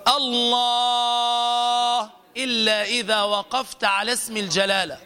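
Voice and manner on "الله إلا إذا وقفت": الله with its long aa stretched very slowly; slow demo of an over-stretched الله; overlong madd demo. Shown as a demonstration. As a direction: drag out الله as the mistake to avoid. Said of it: "فلا تقل".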